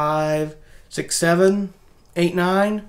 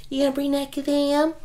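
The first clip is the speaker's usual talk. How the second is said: put-on babysitter voice; 8 semitones higher than usual. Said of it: "No, she goes".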